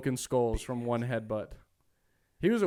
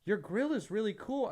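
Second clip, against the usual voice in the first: put-on high little kid voice; light, high child impression; Yodel kid; 7 semitones higher than usual. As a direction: imitate a small boy, pitched up. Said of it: "And he's like".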